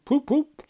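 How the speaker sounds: high, loud honking poop poop; car horn. Acted. Toad plays at driving in his bedroom. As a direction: honk like a motor horn.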